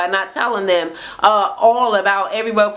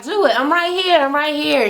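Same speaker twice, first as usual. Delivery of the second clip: higher-pitched goading, imitating a fighter; daring challenge; 6 semitones higher than usual. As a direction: egg the other person on. Retold dare during an argument.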